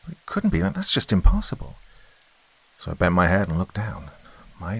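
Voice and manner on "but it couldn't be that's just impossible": high, disbelieving voice; can't believe it, pitched up; disbelief. Acted.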